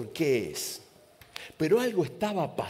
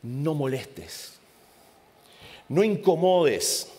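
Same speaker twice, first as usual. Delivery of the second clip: reto de padre a un nene ruidoso; padre regañando; 4 semitones higher, slower than usual. Acted. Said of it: "el grito de un padre a un nene ruidoso". (un padre reta a su nene ruidoso)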